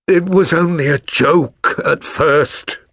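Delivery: slow, drawn-out groan of regret; a caught man's slow, miserable groan. A narrator's reading. Stepfather admitting the scheme to Holmes, groaning. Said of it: "groaned our visitor".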